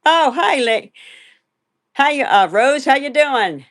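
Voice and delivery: excited hello. Real, not acted. Excited greeting to viewer Rose.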